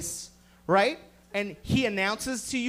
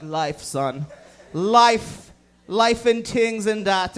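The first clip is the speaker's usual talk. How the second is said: slow, hammed-up Caribbean accent; imitating stoner Caribbean drawl; 5 dB louder, much slower than usual. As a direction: lazily draw out life in a Caribbean accent. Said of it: "And you're like".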